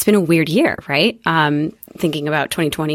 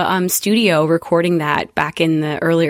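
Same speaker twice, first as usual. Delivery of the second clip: normal talking voice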